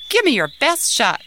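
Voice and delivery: confident. Acted. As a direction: dare him to throw his best. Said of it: "said Ahmed confidently".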